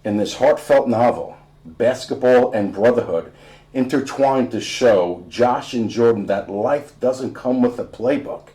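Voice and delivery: usual speaking voice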